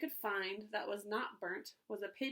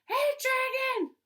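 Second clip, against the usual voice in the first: much louder and higher, slow yell; shouted as loud as she could; 11 dB louder, slower than usual. A narrator's reading. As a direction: yell right into his ear. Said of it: "she shouted as loud as she could".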